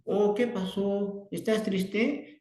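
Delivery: put-on high, slow, gentle questioning to a sad child; caring therapist voice, slow and high; child-directed sympathy. Shown as a demonstration.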